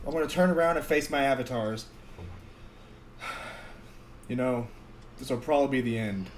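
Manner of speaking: soft, fast, resigned goodbye; a quiet last plea to friends; solemn farewell. Acted.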